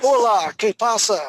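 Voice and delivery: put-on loud, high-pitched Spanish greeting in a clumsy accent; playful attempt at a Spanish accent. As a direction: call it out in amateur Spanish pronunciation. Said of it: "My accent sucks".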